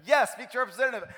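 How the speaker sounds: mock scream, frustrated and rushed; retold screaming. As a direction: re-enact losing it at a phone menu. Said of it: "And I'm screaming at the phone".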